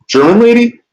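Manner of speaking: can't believe it's her; incredulous question. Real, not acted. Streamer learning the German lady blocked him, incredulous. Streamer reacting to chat news.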